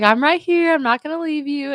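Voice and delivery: mock bawling while reassuring him; imitated tearful reassurance. Bawling wife promises husband she will stay, imitated high reassurance. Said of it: "I was bawling".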